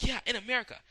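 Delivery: high, loud brash "Yeah, in America"; shouted catchphrase. Retold or imitated. Keith answers Kaiba with a loud brash shout. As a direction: belt the catchphrase.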